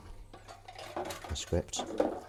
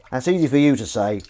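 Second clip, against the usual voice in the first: louder, stress on easy; emphatic; 3 semitones higher, 8 dB louder than usual. Shown as a demonstration. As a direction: punch the word easy.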